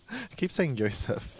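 ordinary delivery

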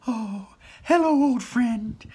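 high, fond creature voice; tender manticore. Acted. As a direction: greet it like an old friend. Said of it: "she said".

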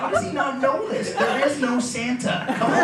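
baffled dad reasoning with himself; incredulous. Retold or imitated. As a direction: play up the disbelief, voice pitched high. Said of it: "awash in incredulity".

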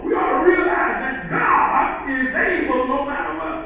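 fervent preacher's shout; preaching. Acted. Preacher proclaiming to the church, loud and fervent. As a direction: proclaim it to the congregation.

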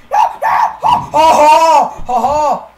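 high shriek into laughter. Real, not acted. Laughing at game fail.

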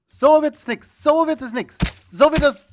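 high, loud frustrated repeats; exasperated calling out. Real, not acted. Repeated failed throws.

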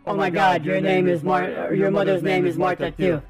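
ordinary delivery

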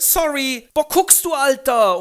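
sehr hoch, laut, prollig; Straßengöre. Acted. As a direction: patzige Göre im Jugendslang. Eliza mit Jugendslang. (hohe, laute Prollstimme)

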